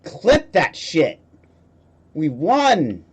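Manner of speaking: loud triumphant cheering; excited shouting. Real, not acted. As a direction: shout it out like you just won. Celebrating a win in game.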